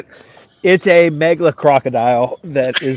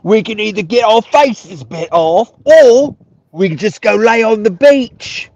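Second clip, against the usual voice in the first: slow, higher mock Australian whale voice, louder as it builds; mock Australian accent; 4 semitones higher, 4 dB louder, slower than usual. A whale weighing its two awful choices, in mock Australian. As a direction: ham up a rough Australian accent, dragging out the grim options. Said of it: "It's not as Australian as I would like".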